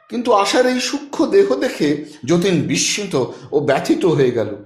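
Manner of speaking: ordinary delivery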